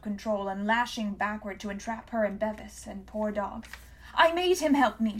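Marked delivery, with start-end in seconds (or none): 4.1-5.2 high, loud shriek; girl shrieking to take the blame. A narrator's reading. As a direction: shriek the confession. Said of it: "she shrieked".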